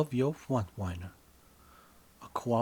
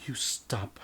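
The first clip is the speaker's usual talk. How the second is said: whispering. A narrator's reading. Whispered narration.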